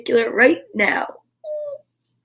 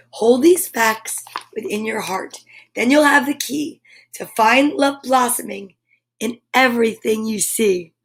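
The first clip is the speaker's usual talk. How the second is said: usual speaking voice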